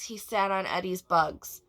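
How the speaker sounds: plain reading voice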